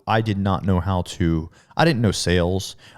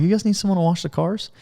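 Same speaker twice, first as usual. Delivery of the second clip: much higher, hopeful question; eager ask; 11 semitones higher than usual. Retold or imitated. Asking the dealership staff for work, much higher eager voice. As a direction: brightly pitch yourself for the job.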